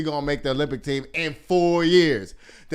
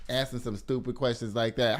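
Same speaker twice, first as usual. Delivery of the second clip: ordinary talk, nothing marked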